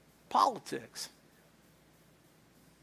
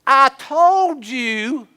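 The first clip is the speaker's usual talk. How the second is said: slow, louder, stretched I told you; exasperated wife; 6 semitones higher, 7 dB louder, slower than usual. Retold or imitated. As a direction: drag out each word at him in frustration. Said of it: "your wife's like this".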